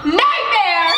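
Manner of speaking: loud horrified cry; shouting. Acted. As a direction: cry out in horror. Exclaims in horror.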